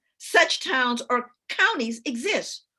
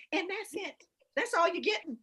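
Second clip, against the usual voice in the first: ordinary talk, nothing marked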